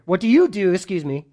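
ordinary talk, nothing marked

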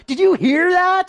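high, loud alarmed ask; spooked by the thunder; alarmed question. Retold or imitated. Pastor acting himself asking others about thunder, high and alarmed. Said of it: "and I'll be like".

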